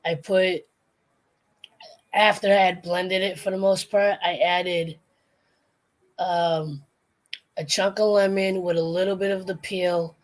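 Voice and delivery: ordinary delivery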